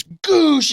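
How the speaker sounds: high loud smack sound; a sharp imitated impact noise; mock slam noise. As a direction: shout the sound of a hand slam. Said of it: "And he goes".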